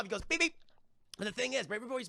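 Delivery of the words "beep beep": high-pitched cartoon "beep beep"; perky imitation beep; Roadrunner beep beep. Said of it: "before he goes off".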